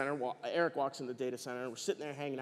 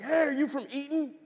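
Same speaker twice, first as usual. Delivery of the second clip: calling out; 11 semitones higher, 4 dB louder than usual. Retold or imitated. Guard calls after Eric running out.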